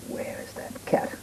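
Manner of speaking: hushed searching whisper. Acted. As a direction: whisper as if sneaking in the dark. Searching in dark.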